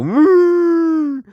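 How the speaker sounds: a huge, high, loud put-on moo; mock elephant moo. Podcast host imitating an elephant for listeners, silly loud moo. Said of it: "That was an elephant for you".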